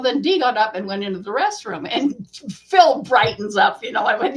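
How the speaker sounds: ordinary talk, nothing marked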